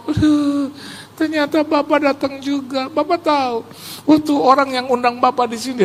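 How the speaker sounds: high, halting, awed voice; imitated overwhelmed fan. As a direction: act out a starstruck host. Menirukan gembala yang terharu. (meniru penggemar terharu dengan suara tinggi)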